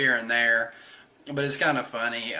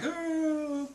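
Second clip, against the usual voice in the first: high excited oh; 5 dB quieter than usual. Retold or imitated. Usopp and Chopper still excited.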